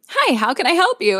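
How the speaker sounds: high-pitched customer service greeting; drive-thru greeter. Retold or imitated. Voices chirpy drive-thru greeting.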